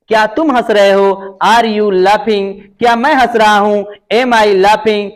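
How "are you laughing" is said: each word slow and spaced; teacher spelling out a sentence; slow dictation. Shown as a demonstration. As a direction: dictate it slowly for the class. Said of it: "slow dictation of English".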